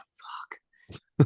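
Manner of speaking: breathless whispered fuck; whispered curse. Retold or imitated. Alone in the bathroom dreading the client, whispered curse. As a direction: hiss the curse under her breath, unvoiced.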